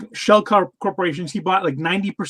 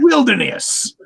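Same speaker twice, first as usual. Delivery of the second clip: put-on old prospector voice; 6 semitones higher, slower than usual. Joining the prospector bit.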